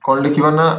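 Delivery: ordinary talk, nothing marked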